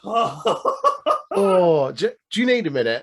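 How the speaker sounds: fast, laughing tease. Real, not acted. Cohost teasing the ranting host, laughing and fast. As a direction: tease him through a laugh.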